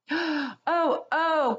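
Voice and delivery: high alarmed cry of dismay. A narrator's reading. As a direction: gasp out in shock. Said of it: "cried the two girls".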